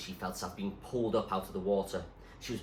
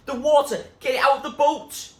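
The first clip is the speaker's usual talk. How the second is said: urgent shout; 13 dB louder than usual. A narrator's reading. Zoe urging over the storm.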